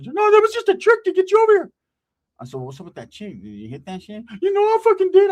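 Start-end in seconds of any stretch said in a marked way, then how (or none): 0.0-1.7 much higher, louder whiny voice; high cartoonish whine of excuses; squeaky Ricky. 2.5-4.2 much softer, low voice; quiet aside about the girl; hushed question. Retold or imitated. Asking quietly about the chick. 4.4-5.3 much higher, louder whiny voice; high cartoonish whine of excuses; squeaky Ricky.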